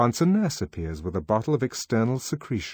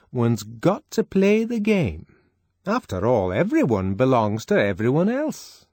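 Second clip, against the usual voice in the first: preachy; 5 semitones higher, 5 dB louder than usual. Acted. Fanny preaching a moral.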